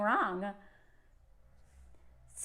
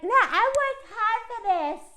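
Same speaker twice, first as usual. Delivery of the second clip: mock smug billionaire; 4 semitones higher than usual. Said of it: "dudes like this are like".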